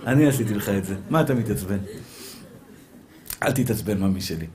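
low, soft, soothing voice of God; God's calm answer. Acted. God calms a man who just got hurt, low soft reassurance. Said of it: "אומר לך הקדוש ברוך הוא".

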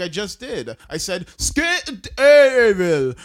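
indignant imitation of a German learner; mock German accent. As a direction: insist in a hammed-up German accent. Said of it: "and they're like".

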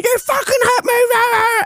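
high, shrill mock yell of the hit woman; imitating a scream. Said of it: "she ran over screaming".

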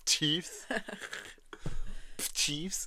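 Chiefs through crooked teeth; slushy lisp. Retold or imitated. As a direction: lisp Chiefs like a snaggle-toothed dog. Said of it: "wenn der das sagen müsste".

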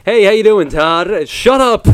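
high, loud chummy hello; overfriendly greeter. Acted. Chummy guy greeting Todd, high and loud.

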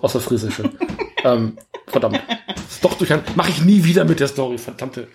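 chuckling through the words; laughing at own mistake. Real, not acted. Host realises he told the wrong plot, laughing. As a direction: laugh off the mix-up.

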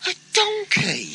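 high, loud astonished cry; surprised cry. Acted. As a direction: gasp it out in disbelief. Surprise entrance of donkey.

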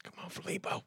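hushed urging whisper. Real, not acted. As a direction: whisper it, willing him to pick up. Waiting for the call to connect.

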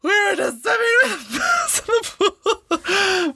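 high, fast, crying laugh of disgust. Real, not acted. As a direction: squeal it out through laughter. Disgust at the mouse.

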